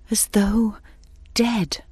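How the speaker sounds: ordinary delivery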